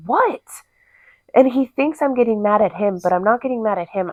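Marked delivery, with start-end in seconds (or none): 0.1-0.4 mock indignant what. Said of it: "And I'm like".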